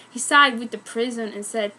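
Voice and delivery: ordinary delivery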